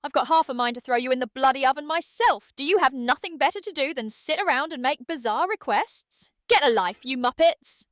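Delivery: indignant British chef rant; upset British Chef Ramsay. Acted. Chef Ramsay roasting viewers for wanting a roast, indignant British accent. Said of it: "unhinged state".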